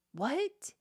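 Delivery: ordinary talk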